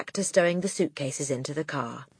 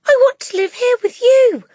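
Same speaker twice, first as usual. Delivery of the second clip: put-on high, loud pleading child; crying out; 10 dB louder than usual. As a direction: cry it out desperately. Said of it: "Matilda cried out".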